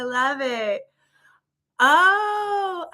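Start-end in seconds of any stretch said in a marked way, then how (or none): 1.8-3.0 high, bursting laugh of joy; delighted laugh. Real, not acted. Host reading a funny comment, laughing high. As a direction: let the laugh spill out.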